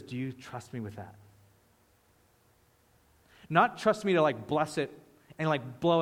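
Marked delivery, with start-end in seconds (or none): 0.0-1.1 hushed, soft question, lower and softer; soft voice of God. Acted. God asking the worshipper gently and quietly. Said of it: "And he says".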